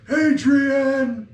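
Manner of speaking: high, loud call of a name; boosted yell. Acted. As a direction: holler it out with a magically boosted voice. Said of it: "and I yell out".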